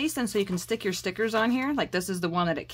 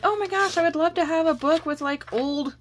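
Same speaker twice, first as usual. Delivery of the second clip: much higher, gushing over wildflower paper; excited gush; 7 semitones higher, 3 dB louder than usual. Retold or imitated. Telling her daughter what she wanted, a high excited gush. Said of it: "And I was like".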